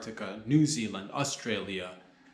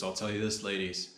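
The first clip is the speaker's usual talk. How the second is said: ordinary talk, nothing marked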